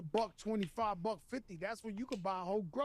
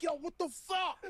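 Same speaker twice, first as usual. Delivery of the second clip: angry dad; 7 semitones higher than usual. Retold or imitated. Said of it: "He was angry".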